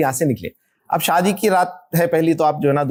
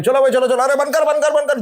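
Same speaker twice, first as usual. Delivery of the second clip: higher-pitched hurried urging, acted out; जल्दबाज़ी भरी पुकार; 9 semitones higher than usual. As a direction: rush everyone along in a raised, higher voice. Acting the hurried wedding car ride. (ऊँची आवाज़ में हड़बड़ी भरी पुकार)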